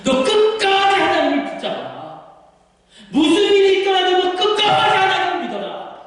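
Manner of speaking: high, sustained preaching cry; urgent parental exhortation. Acted. Parent urging child to hold on to God, fervent exhortation. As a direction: drive it home as a fervent parent.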